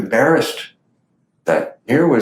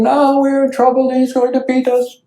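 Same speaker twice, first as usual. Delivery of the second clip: put-on frightened boys; 12 semitones higher than usual. Scared boys voiced in story.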